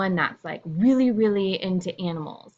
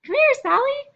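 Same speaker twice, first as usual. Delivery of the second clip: much higher, sweet coaxing call; sing-song high; 4 dB louder than usual. Shown as a demonstration. Teacher demos a high sweet call to Sally. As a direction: coo it up high like calling a pet. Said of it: "I said the same thing in a ton of different ways".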